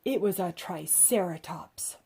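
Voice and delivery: usual speaking voice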